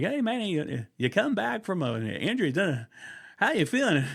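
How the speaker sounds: baseball announcer. Retold or imitated. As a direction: play the eager post-game interviewer. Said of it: "and he's like".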